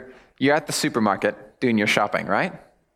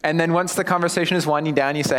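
ordinary talk, nothing marked

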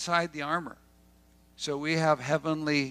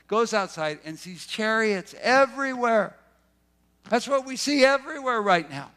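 fervent preaching; 6 semitones higher than usual. Real, not acted. Preacher rises in pitch, fervent.

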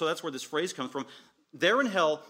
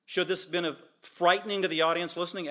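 usual speaking voice

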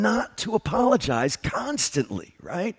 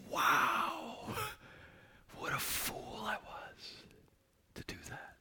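hushed whisper of self-reproach; whispered regret; 10 semitones higher, 19 dB quieter, slower than usual. Retold or imitated. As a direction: breathe it out as a private admission. Voicing the repentant thought.

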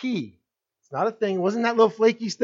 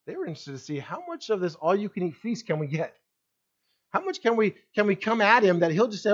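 ordinary talk, nothing marked

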